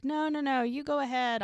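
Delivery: retold runner insisting he carry on; waving him off. Wife waving husband on, acted.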